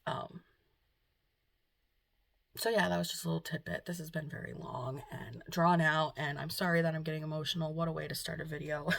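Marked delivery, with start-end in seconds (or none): none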